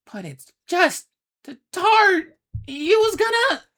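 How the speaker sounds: Grim moaning tearfully about the tart; crying moan. Acted. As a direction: moan through tears. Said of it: "Grim moaned".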